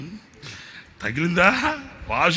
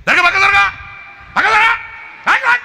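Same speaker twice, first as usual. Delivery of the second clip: much higher, louder chanted shouts; shouting move aside; 8 dB louder than usual. Retold or imitated. Cranking the generator while yelling at the friend, loud shout. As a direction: yell it with each crank.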